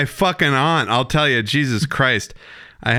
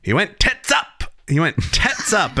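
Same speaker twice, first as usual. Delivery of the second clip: much higher, hammed-up British accent on tits up; mock British accent; 8 semitones higher than usual. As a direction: play a cheeky Brit with a British accent. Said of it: "as they say across the pond".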